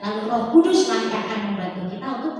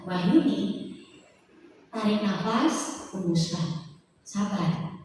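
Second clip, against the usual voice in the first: calm inner voice; 2 semitones lower, much slower than usual. Retold or imitated. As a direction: breathe slowly and calm yourself.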